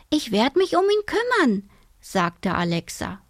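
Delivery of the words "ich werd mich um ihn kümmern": put-on high-pitched insistent child; determined girl. A narrator's reading. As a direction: promise it as an earnest young girl. Girl insists on keeping bird.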